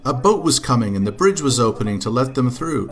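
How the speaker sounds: ordinary delivery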